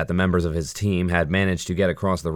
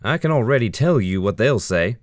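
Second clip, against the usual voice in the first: sarcastic; 6 semitones higher, 4 dB louder than usual. A narrator's reading. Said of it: "with a note of sarcasm".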